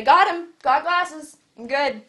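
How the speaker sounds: loud, punchy pretend satisfaction; mock self-satisfied. Pastor mimics someone who just bought glasses, mock self-satisfied. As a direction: announce it proudly in jest, starting high and loud. Acts out a silly glasses owner.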